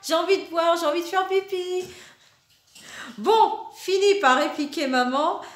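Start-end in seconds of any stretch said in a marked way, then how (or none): 0.1-1.8 put-on whiny child. Child voiced whining in story.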